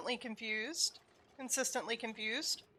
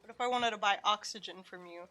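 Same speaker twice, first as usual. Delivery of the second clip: ordinary talk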